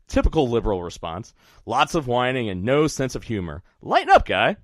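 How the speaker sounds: ordinary delivery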